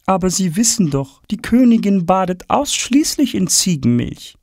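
high, matter-of-fact lady's voice; as if everyone knew that; surprised court lady. Acted. Lady explaining the queen's milk bath to Tom.

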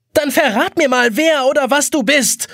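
loud, high angry yell; shouting. A narrator's reading. Trapped boy shouts at the hourglass. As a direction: shout the demand upward. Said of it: "schreit Jonas".

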